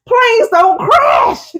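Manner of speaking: high, slow laughing cry; slow laughing wail of disbelief. Real, not acted. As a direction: laugh it out in shock.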